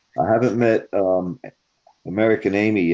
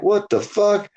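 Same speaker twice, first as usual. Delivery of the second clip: much higher, startled retold outburst; shocked exclamation; 12 semitones higher, slower than usual. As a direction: blurt it out in disbelief. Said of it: "I'm like".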